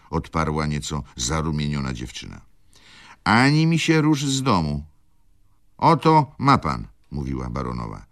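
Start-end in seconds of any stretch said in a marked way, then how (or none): 3.2-6.9 high, loud order; indignant scolding. A narrator's reading. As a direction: lay down the law in outrage. Baroness scolds girl, complains. (oburzony, podniesiony ton)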